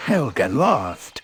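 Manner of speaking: bouncy cartoon tiger voice; lightly voiced bouncy tiger; Tigger voice. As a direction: play Tigger, cocky and breezy.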